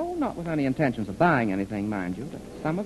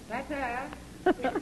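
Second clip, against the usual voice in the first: high, dainty farewell; airy goodbye; 10 semitones higher, 4 dB quieter than usual. Acted. Clarence leaving the girls for the shop, dainty ta-ta. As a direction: flounce off.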